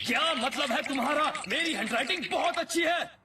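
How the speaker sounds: very high, offended squawk; indignant protest. Acted. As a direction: protest in a comically high, outraged voice. Stung by insult to handwriting.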